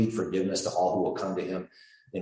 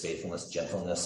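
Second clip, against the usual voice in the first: usual speaking voice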